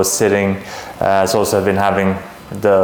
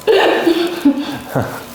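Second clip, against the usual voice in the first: laughing. Real, not acted. Doctor laughs along.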